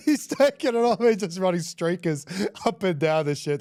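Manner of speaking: high-pitched telling through real laughter; laughing hard. Real, not acted. Teammate recalling a prank in the sheds, laughing hard. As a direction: tell it while cracking up.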